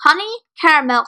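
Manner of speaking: usual speaking voice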